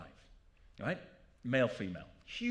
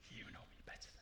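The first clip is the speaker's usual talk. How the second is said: ironic whisper; 20 dB quieter, faster than usual. Real, not acted. Ironic aside to audience.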